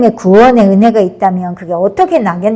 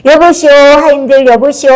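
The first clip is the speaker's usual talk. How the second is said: raised, higher-pitched cry to the servants; louder, pitched-up mock outcry; loud calling out; 5 semitones higher, 4 dB louder than usual. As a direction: call out loudly as a righteous accuser. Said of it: "큰 소리로".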